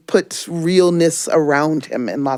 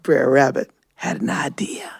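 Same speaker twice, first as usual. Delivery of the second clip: whisper; 7 semitones lower, 4 dB quieter than usual. A narrator's reading. Rabbit hatching a trick, whispered. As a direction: sly, lower whisper. Suspense narration.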